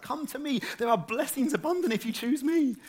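high, insistent calling voice; crying out. Acted. Preacher as Woman Wisdom calling people to her, high-pitched pleading call. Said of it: "She's there crying out, shouting, pleading".

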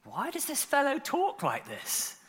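high-pitched mock objection; disapproving teacher. Teachers of the law thinking to themselves, high-voiced disapproval. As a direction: put on a high, affronted voice. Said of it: "thinking to themselves".

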